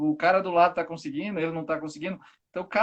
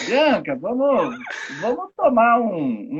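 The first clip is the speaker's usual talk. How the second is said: louder, drawn-out call to a friend; acted invitation; 3 semitones higher, 5 dB louder, slower than usual. Speaker pretends to invite Bianca for a drink, mild coaxing. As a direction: lightly act out coaxing a friend along. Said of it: "Aí eu falo assim".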